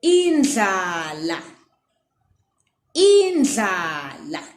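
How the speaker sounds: indlala slowly, with a lateral dl; slow teaching pronunciation; careful Ndebele word. Shown as a demonstration. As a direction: stretch indlala for the learner. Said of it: "The first word I have for you is".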